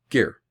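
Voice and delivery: short neutral vowel in gear. Shown as a demonstration. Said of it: "a sort of a short I sound".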